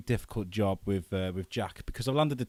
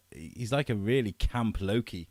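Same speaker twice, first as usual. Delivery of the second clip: ordinary talk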